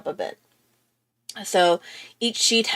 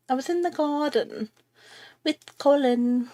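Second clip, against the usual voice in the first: put-on sudden British accent; 6 semitones higher than usual. Telling viewers she gardened with Colin, British accent. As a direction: slip into a British accent for a line. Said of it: "why I went British".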